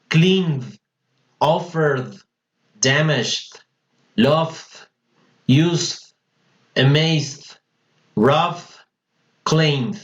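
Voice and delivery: each word ending in a voiced D, E silent; "cleand, offerd, damajd", words set apart by pauses. Shown as a demonstration. Teacher drills voiced endings, CLEANED to CLAIMED ending in D. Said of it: "Suena solo la D, no la E D".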